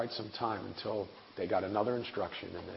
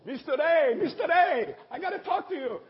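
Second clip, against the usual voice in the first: very high, loud excited voice; excited Malawian pastor; 11 dB louder than usual. Retold or imitated. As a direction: burst out to tell a father about his son. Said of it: "this little pastor from Malawi, going".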